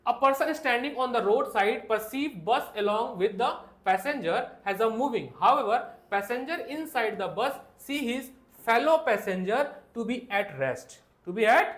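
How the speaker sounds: reading the NCERT line aloud word by word; drawn-out dictation to the class; slow textbook reading. Shown as a demonstration. Teacher reading the textbook line slowly to students.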